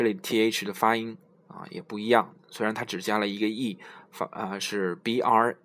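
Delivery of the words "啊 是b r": usual speaking voice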